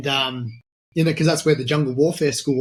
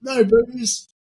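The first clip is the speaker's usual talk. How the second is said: incredulous; 6 semitones higher, slower than usual. Retold or imitated. Quoting mate's rules, incredulous.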